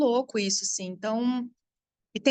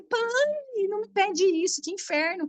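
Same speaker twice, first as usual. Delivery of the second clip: 1 exasperated; 9 semitones higher than usual. Retold or imitated. Retold argument with father.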